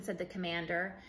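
ordinary delivery, nothing marked

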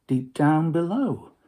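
ordinary delivery

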